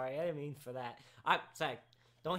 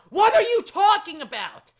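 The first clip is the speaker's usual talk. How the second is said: very high, very loud baffled yell; exasperated shout; 14 semitones higher, 16 dB louder than usual. Real, not acted. Host baffled by a viewer's question, shouting. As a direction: repeat it as a full yell.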